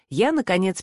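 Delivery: plain reading voice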